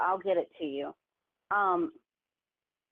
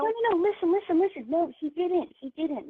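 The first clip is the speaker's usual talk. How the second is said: frantic pleading; 8 semitones higher than usual. Real, not acted. Sister frantically hushing her angry brother on the call, high panicked pleading. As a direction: talk over him in a panicked rush to calm him.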